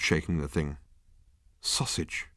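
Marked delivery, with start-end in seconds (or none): 1.6-2.3 soft unvoiced murmur to himself; whispered thought. A narrator's reading. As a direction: breathe it as a private thought. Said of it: "thought Arthur to himself".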